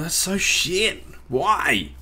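drawn-out, whiny disbelief; exasperated dismay. Real, not acted. Player cursing a bad enchantment, exasperated. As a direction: stretch it out in dismay.

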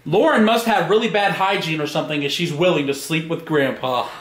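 ordinary delivery

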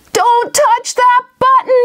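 high, loud, drawn-out warning; alarmed cry. Acted. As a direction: shriek a warning at the boy. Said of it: "cried Gizmo".

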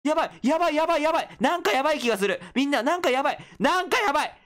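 high frantic panic, rising to a shout; freaking out as the horror map hits; panicked. Real, not acted. Streamer panicking at a scary map, high frantic yelps. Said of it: "クソ怖えや".

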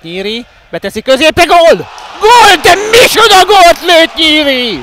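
huge, high, drawn-out goal yell; excited goal shout. Real, not acted. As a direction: scream the goal, stretching it out. Goal scored, commentator erupts. (hatalmas, elnyújtott gólkiáltás)

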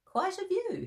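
lifted high, marvelling at the view; a bright high exclamation; high quoted voice. A narrator's reading. Walter marvelling at the view, said high. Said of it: "he said to himself".

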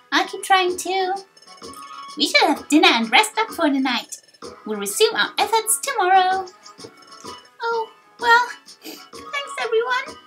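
high, eager youngster voice; hopeful high voice, softening into thanks; earnest young hero. Acted. Young hero rallies the guild.

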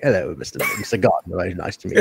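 low, polite British gentleman; imagined genteel British accent, quietly spoken; soft-spoken British accent. Pretend. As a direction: put on a mild-mannered British accent. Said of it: "very soft-spoken guy in person with a British accent".

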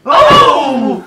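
loud high shout. Acted. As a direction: cry out suddenly.

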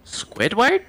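cartoon quote voice. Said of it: "what he says to him".

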